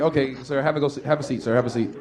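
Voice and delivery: lightly voiced bored guard; bored security guard. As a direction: wave him off like a bored guard. Bored security guard dismisses him.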